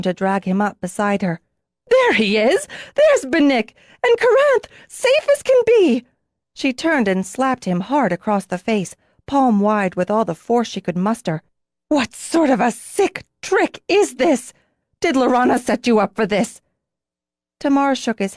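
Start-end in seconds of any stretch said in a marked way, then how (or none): 1.9-6.0 rising to a very high, loud, joyous cry; slowing on the last words, jubilant shout; overjoyed cry. Acted. Sees her lost mate alive. 11.9-16.6 furious. Acted. Slaps him, furious accusation.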